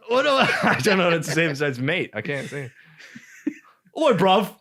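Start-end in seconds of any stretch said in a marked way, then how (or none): 4.0-4.5 loud, high Cockney slang; trying Cockney slang, hammed-up; mock Cockney. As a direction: bark it like a Londoner. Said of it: "Yeah, there you go".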